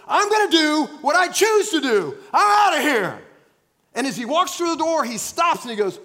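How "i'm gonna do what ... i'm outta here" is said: high and loud defiance; defiant teen shout. Acted. Teenager leaving his father's house, defiant loud shout. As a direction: fling it back as you leave.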